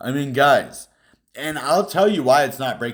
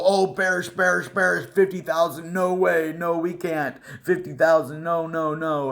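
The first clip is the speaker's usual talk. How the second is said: higher, hammed-up panicky chant; mock bearish chorus; 6 semitones higher than usual. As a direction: mimic a crowd crying doom. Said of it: "all the people".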